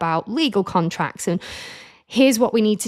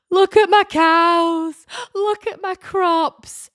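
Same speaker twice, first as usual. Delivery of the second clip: very high, slow and louder mock delight; gushing excitement; 11 semitones higher, 5 dB louder, much slower than usual. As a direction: show off the cows like a proud child. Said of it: "I'm like".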